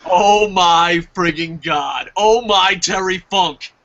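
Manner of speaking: slow, drawn-out awe; fanboy moment. Retold or imitated. Fan realizing Mystico may come, drawn-out awe. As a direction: stretch each exclamation in starstruck amazement. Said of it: "I had a fanboy moment".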